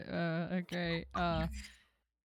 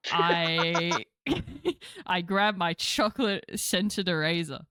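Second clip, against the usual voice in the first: usual speaking voice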